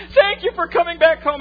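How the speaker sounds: very high, loud imitation of a gushing wife; hammed-up squeal of gratitude; overjoyed wife. Preacher voices a wife embracing her returning husband, overjoyed.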